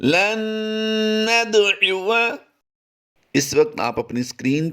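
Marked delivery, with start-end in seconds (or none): 0.0-2.4 लन नदउवा chanted slowly and high with nasal ghunna on न; Quranic recitation. Shown as a demonstration. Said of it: "last time इसकी अदायगी फिर कर लीजिए".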